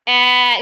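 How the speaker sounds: ए held long and steady; long vowel. Shown as a demonstration. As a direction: stretch out the sound ए. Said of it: "लंबा sound जो है वो produce करें". (ए को लंबा खींचकर)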